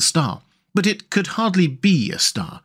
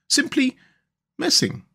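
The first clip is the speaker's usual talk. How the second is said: high, lingering musing; wistful, much higher character voice; dreamy; 14 semitones higher than usual. A narrator's reading. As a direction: drift off on each word. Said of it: "he went on dreamily".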